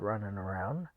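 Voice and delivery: tossed off casually; unbothered aside; nonchalant husband. Retold or imitated. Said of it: "just very nonchalantly".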